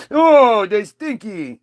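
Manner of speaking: surprised simpleton; high dopey exclamation; dim Eve. Retold or imitated. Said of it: "Eve answers snake".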